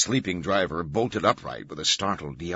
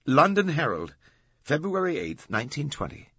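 higher-pitched, smiling announcement; smug reveal; 8 semitones higher, 4 dB louder than usual. A narrator's reading. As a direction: present the citation triumphantly. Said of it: "Glick smiled".

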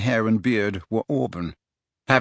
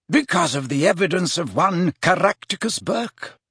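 lightly voiced mentor, higher-pitched; Dumbledore; 7 semitones higher than usual. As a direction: explain it with a touch of learned emphasis. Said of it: "said Dumbledore".

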